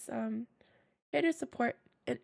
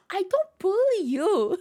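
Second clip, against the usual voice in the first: mock protest; 7 semitones higher, 7 dB louder than usual.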